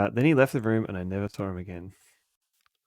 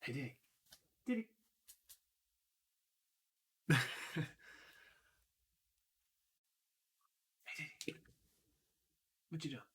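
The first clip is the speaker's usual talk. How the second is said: hushed sweet words to a dog; whispering; 5 semitones higher, 14 dB quieter, faster than usual. Real, not acted. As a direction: softly coax the dog.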